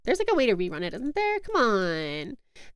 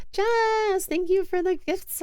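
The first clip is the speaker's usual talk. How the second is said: higher, bright-eyed thank you to a gifter; excited thanks; 8 semitones higher, slower than usual. Real, not acted. Streamer thanking a gifter for subs, excited. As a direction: gush a quick thanks to Jess.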